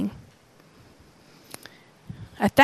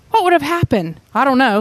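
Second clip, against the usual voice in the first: ordinary delivery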